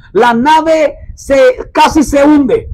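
ordinary delivery

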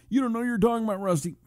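high put-on voice of an objector; mocking a heckler. Voices a heckler, then answers him.

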